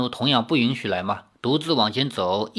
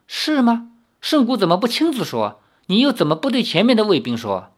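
high, skeptical questioning; half doubting; 9 semitones higher than usual. A narrator's reading. Gai Luojiao doubting Li's story, skeptical. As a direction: press him as if you don't buy it. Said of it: "半信半疑".

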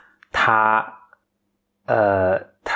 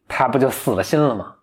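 ordinary talk, nothing marked

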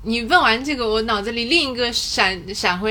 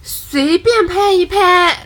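teasing; 8 semitones higher, 7 dB louder, much slower than usual. Real, not acted. Mocking repeat of phrase.

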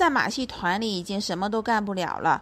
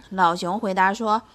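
usual speaking voice

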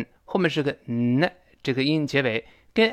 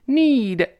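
"Need" much higher and louder, long falling ee; stressed long vowel; 11 semitones higher, 6 dB louder than usual. Shown as a demonstration. Teacher repeats the stressed word, long vowel. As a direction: punch Need, drawing out the ee. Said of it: "发长音一的声音".

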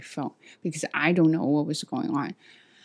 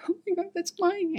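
much higher, thrilled recognition; excited squeal; 13 semitones higher, 4 dB quieter than usual. Retold or imitated. Spotting her orange phone in his hand, high excited squeal. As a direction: squeal as you spot your phone.

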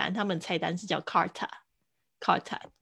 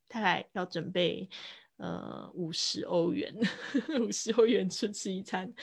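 normal talking voice

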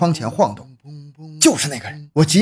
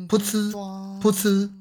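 spurting sound; 6 semitones higher, 7 dB quieter than usual. Acted. Mouth sound of spurting blood.

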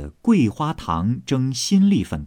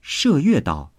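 usual speaking voice

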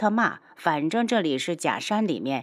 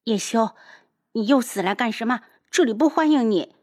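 higher-pitched, irritated rejection; annoyed and quick; unwilling, hostile; 4 semitones higher than usual. Acted. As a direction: send an unwelcome visitor away crossly. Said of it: "不情愿地转身".

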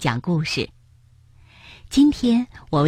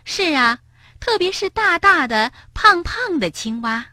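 lightly voiced higher-pitched stork wife; doctor's wife chiming in; 11 semitones higher than usual. A narrator's reading. Stork wife backs up her husband, chiming in. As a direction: add keenly, in a slightly put-on higher voice. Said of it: "博士太太补充道".

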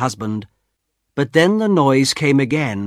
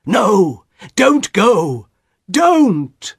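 raised, urgent plea rising in pitch; alarmed husband begging, higher on the last word; desperate cry; 7 semitones higher, 4 dB louder, much slower than usual. A narrator's reading. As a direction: cry out to hold her back. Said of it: "her husband cried again".

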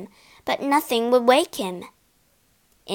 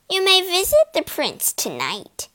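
higher voiced troll queen replying; troll queen granting a visit, higher voice; 9 semitones higher than usual. A narrator's reading. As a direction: offer it coolly, pitched up. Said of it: "the Troll Queen replied".

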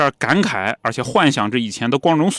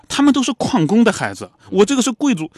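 higher and louder, lightly playing a snobbish earl; haughty retort; 9 semitones higher, 4 dB louder than usual. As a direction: look down your nose at the doctor. (高声傲慢地反驳医生)

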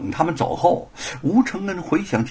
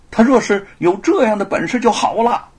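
sarcastic; 7 semitones higher, 6 dB louder than usual. Acted. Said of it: "一个衙役挖苦地说".

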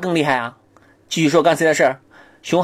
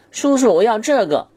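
put-on high, slow kid's plea; child begging; 8 semitones higher than usual. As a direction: whine for the toy.

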